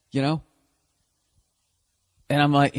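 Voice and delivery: ordinary delivery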